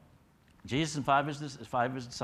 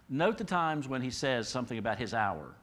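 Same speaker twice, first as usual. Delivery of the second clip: ordinary talk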